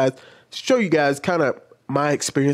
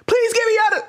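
very high, hammed-up panicked plea; shrill-pitched imitation of desperate begging; dramatic mock yell; 4 dB louder than usual. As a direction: wail it like someone being overdramatic. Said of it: "I'm gonna yell in y'all's ear".

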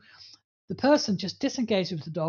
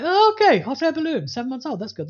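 high, cheerful puppy voice; carefree young dog; 8 semitones higher, 9 dB louder than usual. Retold or imitated. Seven-month-old puppy shrugging off a balloon, high and breezy. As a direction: bounce it like an unbothered puppy. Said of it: "the youngster was like".

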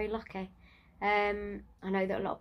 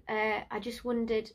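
ordinary talk

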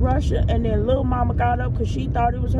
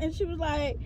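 ordinary delivery

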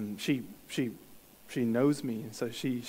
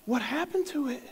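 higher, hammed-up shock over his hair; mock alarm; 8 semitones higher than usual. Playing along with a little girl, mock alarm. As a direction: fake panic to play along with the child. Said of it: "I decided to play along".

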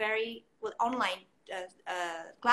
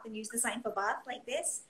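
usual speaking voice

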